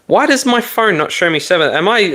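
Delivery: high, baffled question; puzzled and thrown by the phone; surprised. Real, not acted. Confused at phone's like count.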